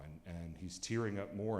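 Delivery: usual speaking voice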